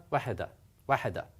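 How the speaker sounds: wahada, wahada rattled off quickly with a single h; very fast. Shown as a demonstration. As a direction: rush through wahada, wahada. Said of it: "Very, very fast".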